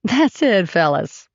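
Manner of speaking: a mild, playful announcement; announcing. A narrator's reading. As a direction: announce it lightly to the room. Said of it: "Shar announced".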